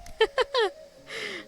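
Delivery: light high laugh, falling away; high giggle. Real, not acted. Streamer giggling fondly at Kale, high laugh. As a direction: giggle at the sweet line. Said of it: "That's so sweet of you".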